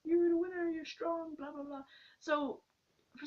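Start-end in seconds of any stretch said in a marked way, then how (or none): 0.0-1.8 much higher, sing-song prize-bell announcer; lightly mimicked arcade game announcer; carnival machine voice. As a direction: play the strength-tester chiming at the winner. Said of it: "and then it goes like".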